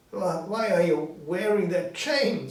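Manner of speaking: high, loud fearful stammer; trembling question. Acted. Scrooge trembling before Marley's chain, high and loud. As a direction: ask with a shaky start. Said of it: "Scrooge asked, trembling".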